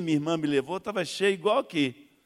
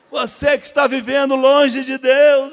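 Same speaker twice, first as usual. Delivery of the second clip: much louder, high, slow preaching shout; preacher's altar call; 9 semitones higher, 14 dB louder, slower than usual. Retold or imitated. Preacher calling out the sinner, booming slow shout. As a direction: boom it to the whole church. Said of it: "me chamou no final".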